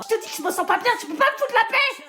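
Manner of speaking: high angry snap at mom. Acted. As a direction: lash out, fed up and loud. Sick teen snaps at mother.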